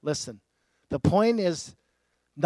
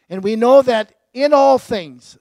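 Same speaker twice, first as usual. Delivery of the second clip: louder, higher, pressing on know; preaching emphasis; 6 semitones higher, 12 dB louder than usual. Acted. Preacher booming "know" to the congregation. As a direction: lean hard on "know" like a preacher.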